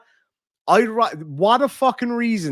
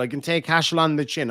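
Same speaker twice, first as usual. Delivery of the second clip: ordinary talk, nothing marked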